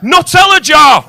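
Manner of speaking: high, loud mock praise; loud, slow sarcastic genius; sarcastic shout. Real, not acted. Mock praise of absurd container.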